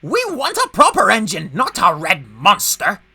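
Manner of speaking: loud, high, indignant truck voice; screaming protest. A narrator's reading. Trucks rejecting their red engine, loudly indignant. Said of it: "screamed the trucks".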